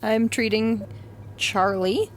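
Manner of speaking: ordinary delivery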